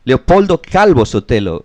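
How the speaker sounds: normal talking voice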